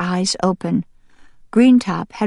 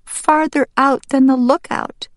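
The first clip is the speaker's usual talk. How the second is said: put-on higher-pitched, plaintive young duck; upset young duck Green Top; 8 semitones higher, 3 dB louder than usual. Young duck tells his mom he's always a loner, plaintive. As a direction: complain to mother in a high child-character voice.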